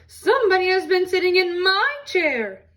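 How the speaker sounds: a scandalised mother bear; Mama Bear indignant. Acted. Mama Bear in the parlor, outraged about her chair, high and loud.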